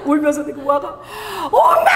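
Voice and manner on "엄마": high, loud cry of mom; sudden high cry; daughter calling. Retold or imitated. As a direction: call out in panic.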